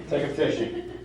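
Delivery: usual speaking voice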